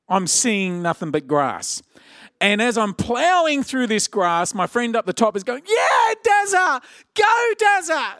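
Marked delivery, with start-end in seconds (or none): 5.7-8.1 high, drawn-out mate's cheer; imitating a friend's excited yell; cheering shout. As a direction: holler encouragement down a hill, stretching the words.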